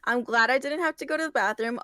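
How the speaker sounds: ordinary talk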